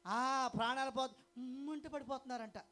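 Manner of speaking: loud, high fiery preaching; full-force preaching. Acted. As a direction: thunder it at the congregation. (గట్టిగా ఉత్సాహంగా ప్రసంగం)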